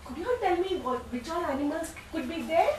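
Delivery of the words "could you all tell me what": usual speaking voice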